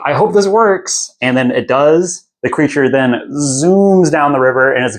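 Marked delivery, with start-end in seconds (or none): 0.1-1.2 high, tense, hopeful mutter; cross your fingers and squeak it out; a lightly voiced anxious hope; nervous hope. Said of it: "is like".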